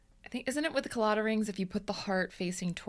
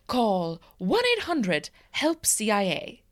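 mock ad read; 2 semitones higher, 5 dB louder than usual. Mock hotline ad.

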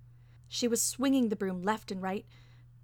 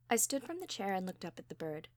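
plain reading voice

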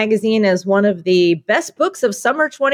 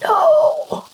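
wailing no. Acted. Customer wails a denial.

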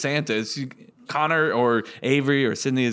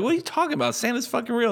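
put-on high, indignant kid protesting; a boy insisting Santa is real; 9 semitones higher than usual. Kids mocking a boy about Santa.

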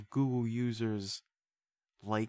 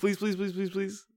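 high-pitched mock begging; pleading; 8 semitones higher than usual. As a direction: beg in a rapid, higher string. Begging for the voice bit.

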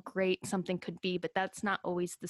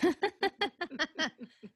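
giggle; 9 semitones higher than usual. Real, not acted. Laughs after "scandalous.

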